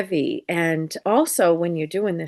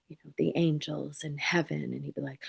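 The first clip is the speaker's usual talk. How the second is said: whisper; 3 semitones lower, 9 dB quieter than usual. Retold or imitated. Gentle talk about heaven.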